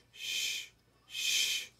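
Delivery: hushing. A narrator's reading. Mouse hushing the birds to let the fawn sleep. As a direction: hush him gently. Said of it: "hushed the mouse".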